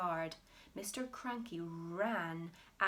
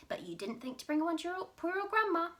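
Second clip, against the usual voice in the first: higher, fast, loud; self-pitying whine; 10 semitones higher, 6 dB louder, faster than usual. Acted. Grandma whining at George. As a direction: whine pitifully.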